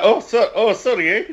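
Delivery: high, loud mock-Canadian apology; hammed-up Canadian "sorry, eh"; Canadian accent. Podcast host playing an apologetic Canadian, in a hammed-up Canadian accent. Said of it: "That's a horrible Canadian accent".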